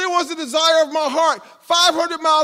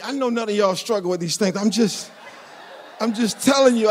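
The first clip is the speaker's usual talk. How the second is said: ordinary talk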